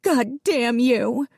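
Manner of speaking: mother cursing the men through tears, high and slow; tearful curse. A narrator's reading. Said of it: "tears leaking out of her eyes".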